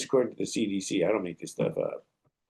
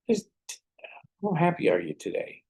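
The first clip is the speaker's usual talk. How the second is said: bright, high, eager check-in with the group; upbeat question; 10 semitones higher than usual. Retold or imitated. Coach asking the group, high and eager. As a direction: pump up the room with a cheery question.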